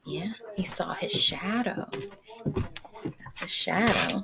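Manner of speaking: a hushed whisper. Real, not acted. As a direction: lean in and whisper it. Hushed answer to the children.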